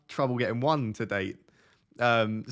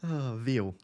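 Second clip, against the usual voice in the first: mock Welsh "veal"; light Welsh lilt; Welsh accent; 3 dB quieter than usual. Shown as a demonstration. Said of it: "You sound Welsh now".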